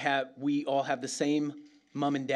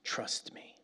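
whisper; 10 dB quieter than usual. Acted. Opening whispered line of sermon.

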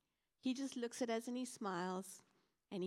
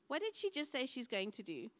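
ordinary talk, nothing marked

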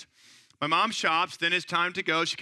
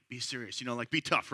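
put-on much softer and lower, an aside to the child; hushed prompting; 6 semitones lower, 7 dB quieter than usual. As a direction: imitate mom's low, hushed coaching. Mom coaching child from behind.

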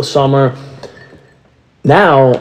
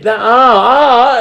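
dawning realization; 10 semitones higher, much slower than usual. Retold or imitated. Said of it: "I'm like".

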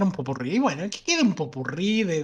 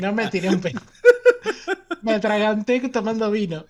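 laughing; 7 semitones higher, 6 dB louder than usual. Real, not acted. Laughing after choking on wine.

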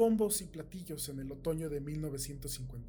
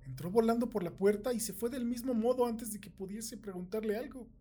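higher, puzzled questioning; baffled demand; 6 semitones higher, 4 dB louder than usual. A narrator's reading. Bill asks Juan about Sara's hurried visit, baffled. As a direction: demand to know what is going on.

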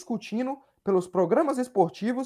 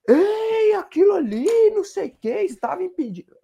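much higher, put-on voice mimicking a friend; imitating Dudu; 9 semitones higher, slower than usual. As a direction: squeak it out like mocking a buddy. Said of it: "que o Dudu ficou".

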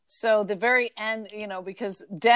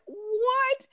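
creeped-out disbelief. Acted. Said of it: "vicar starts to get creeped out".

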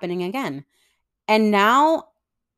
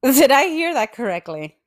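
stunned disbelief; 5 semitones higher, 3 dB louder than usual. Real, not acted. Stunned by news of death.

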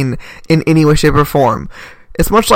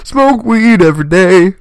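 lightly raised, drawn catchphrase voice; 3 semitones higher, 3 dB louder than usual. Acted. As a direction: toss out a mock stoner slogan. Joking catchphrase after "four twenty.

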